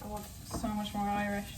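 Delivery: a quiet attempt at an Irish accent; hint of an Irish mum; impressão irlandesa leve; mock Irish impression. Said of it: "my impressions of her are always like".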